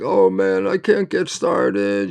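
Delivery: imitating a fed-up young job seeker; discouraged young person. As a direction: play the frustrated kid who can't get going. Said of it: "a lot of people think".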